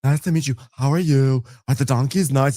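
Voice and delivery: high, loud bubbly greeting; excited gushing. Retold or imitated. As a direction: gush at the donkey owners. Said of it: "Lisa's like".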